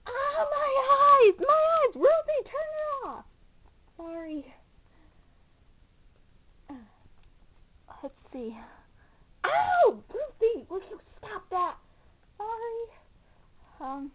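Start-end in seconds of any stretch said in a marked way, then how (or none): 0.1-3.2 high, loud pained shout; loud, high dazzled complaint; pained yell. Acted. Flashlight shone in her eyes. 9.4-11.8 high, loud yelp that settles; yelp, then scold her; high annoyed complaint; pained yell. Acted. Flashlight in eyes again.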